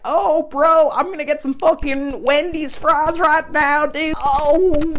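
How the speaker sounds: about to dig into the fries and can't wait; squealing food hype; hyped excitement. Retold or imitated.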